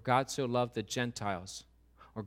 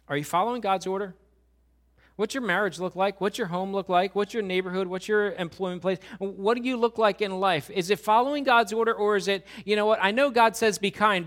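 rising, louder and higher questions to the congregation; preaching at full force; 6 semitones higher, 9 dB louder than usual. Real, not acted. Pastor challenging the church at the close, full force preaching. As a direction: preach it hard, building to the end.

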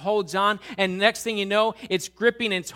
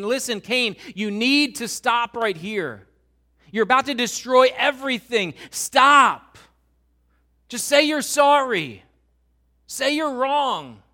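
louder and higher, then a shouted stop; urgent warning; 5 semitones higher, 7 dB louder than usual. Acted. God blocking Cain's path, urgent louder warning. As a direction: plead hard to stop him before it's too late.

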